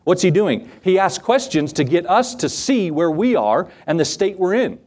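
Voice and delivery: usual speaking voice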